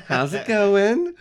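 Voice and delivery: high, loud, drawn-out silly greeting; slow comic falsetto hello; mock awkward greeting. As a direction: sing it out sheepishly in falsetto. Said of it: "He's like".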